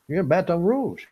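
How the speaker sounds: playful fake Cajun lilt; fake Cajun accent. Shown as a demonstration. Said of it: "I'll do my fake Cajun accent".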